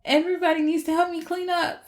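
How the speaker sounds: rallying everyone in a panic; frantic Thor. Retold or imitated.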